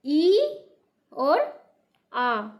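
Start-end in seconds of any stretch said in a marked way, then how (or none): 0.0-0.6 ई said higher and louder; teacher naming the vowel sign; emphatic ee. Shown as a demonstration. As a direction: call out the ई clearly. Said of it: "कौन कौन सी?".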